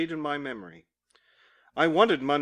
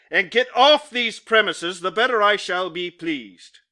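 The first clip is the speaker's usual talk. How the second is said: louder, slowed and pressed out; emphatic; 2 semitones higher, 6 dB louder than usual. Acted. Employer ordering the clerk off the premises, emphatic and loud. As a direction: drive each word home to throw him out. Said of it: "here he was very emphatic".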